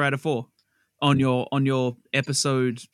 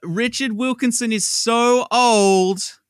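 slow, high and louder; hammed-up announcement; 6 semitones higher, 3 dB louder, slower than usual. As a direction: stretch it out like a punchline. Running gag, third go.

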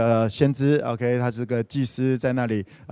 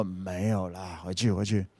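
much lower and softer, dismissive mumble; sleepy old Eli; 7 semitones lower, 9 dB quieter than usual. Acted. Old Eli waves Samuel back to bed, low and sleepy. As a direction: brush the boy off half asleep.

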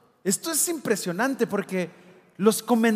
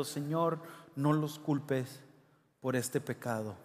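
soft dying plea; 6 semitones lower, 9 dB quieter than usual. Retold or imitated. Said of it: "cayó de rodillas gritando".

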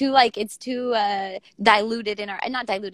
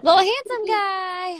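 much higher, slow cooing; 8 semitones higher, slower than usual. Real, not acted. Cooing at the child on camera.

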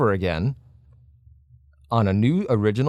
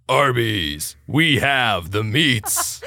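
slow, dragged-out ad announcer; Arby's commercial voice; much slower than usual. Shown as a demonstration. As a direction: stretch out the meats like a TV ad. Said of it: "his voice acting".

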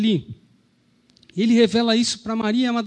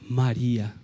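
soft, lower, tender naming; tender calling of her name; 8 semitones lower, 6 dB quieter than usual. Acted. Jesus softly calling Mary by name, tender.

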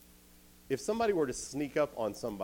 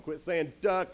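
exasperated higher, louder shout; annoyed shout; 8 semitones higher, 7 dB louder, slower than usual. Retold or imitated. A soldier yelling at Gideon to quit the chant. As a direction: yell at him to stop. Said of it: "somebody's going".

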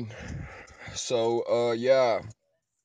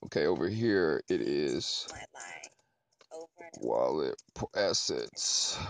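breathy aside to self; whisper; 5 semitones lower, 5 dB quieter than usual. Real, not acted. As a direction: whisper it under your breath. Aside while navigating the wallet.

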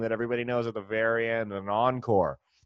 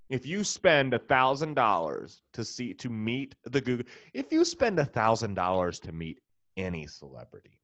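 normal talking voice

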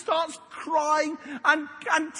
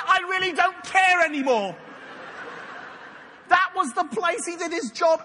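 mock-outraged rant; 3 semitones higher than usual. Preacher rails at Simon Peter's useless fishing, mock outrage. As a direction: rant in exaggerated disbelief at his incompetence. Comic rant about rubbish fisherman.

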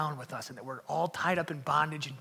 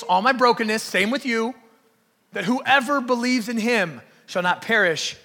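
loud, raised, emphatic preacher voice; full-force preaching; 6 semitones higher, 11 dB louder than usual. Real, not acted. Preacher including everyone, baggage and all, full-force preaching. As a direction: belt it out to the whole congregation.

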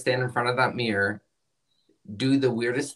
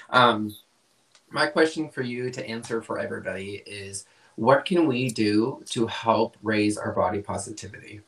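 ordinary talk

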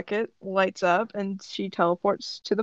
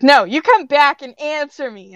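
raised, higher commanding voice; heated insistence; demanding; 5 semitones higher, 6 dB louder than usual. A narrator's reading. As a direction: order them back sharply. Character demands answer from one leaving.

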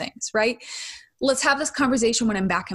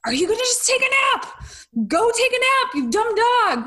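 exasperated yelling at the dog; 12 semitones higher than usual. Retold or imitated. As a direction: snap at the dog like you've lost patience. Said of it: "I was like".

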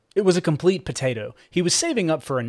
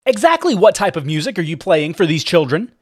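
indignant; 5 semitones higher, 7 dB louder than usual. Acted. Angry mother confronts Cooper.

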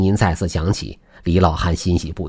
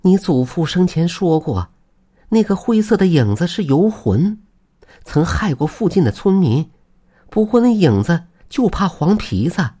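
high-pitched wife voice; excited high female character voice; wife's sudden realization; 10 semitones higher than usual. Acted. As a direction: pitch it up high, as if it has just dawned on you. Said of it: "忽然王氏一拍大腿说道".

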